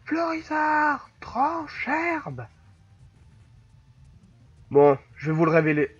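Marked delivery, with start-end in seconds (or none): 0.0-2.5 high-pitched shouted battle command; put-on high trainer voice; trainer character voice. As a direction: call out the attack high and drawn out. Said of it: "J'invente les voix au perso".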